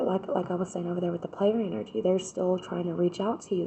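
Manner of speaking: normal talking voice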